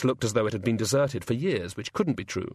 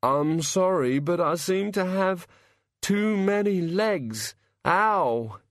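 put-on woozy young man; 7 semitones higher, slower than usual. A narrator's reading. Hungover boy woozy.

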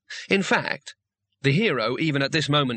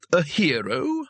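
loaded sneer; 3 semitones higher, slower than usual. A narrator's reading. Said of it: "pack a lot of meaning into the one word".

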